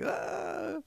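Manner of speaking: a sudden alarmed high gasp of fear; frightened yelp. Shown as a demonstration. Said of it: "when people are frightened or alarmed".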